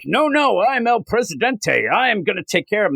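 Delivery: high, fast villain boast; El Presidente voice. Retold or imitated. President brushing off Lois, high fast mock villain. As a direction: squeak it out quickly like a smug ruler. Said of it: "And then he goes".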